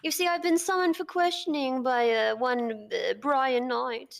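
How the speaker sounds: mock posh British. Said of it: "we do this in our British accents".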